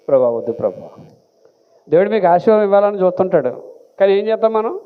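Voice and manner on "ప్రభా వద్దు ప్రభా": much lower, reluctant whimper; whiny Moses. Acted. Moses refusing God, lower and whining. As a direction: beg off like a timid man.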